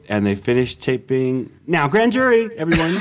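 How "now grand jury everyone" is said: loud, high announcing; excited announcement. Retold or imitated. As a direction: shout it like a herald. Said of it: "They're like".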